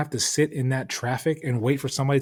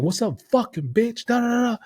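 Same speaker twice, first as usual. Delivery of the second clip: high-pitched re-enacted taunt; acted taunting; 12 semitones higher than usual. Hanging out the window at the driver, acted taunting. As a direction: jeer up high, tailing into nonsense syllables.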